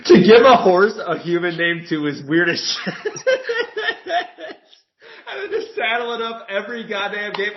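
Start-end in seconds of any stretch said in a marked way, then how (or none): 3.0-4.5 high burst of laughter; cracking up high. Real, not acted. Laughing at horse joke. 5.3-7.5 laughing through words; high, laughing rant. Real, not acted. Laughing while ranting.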